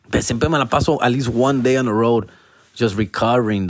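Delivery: ordinary delivery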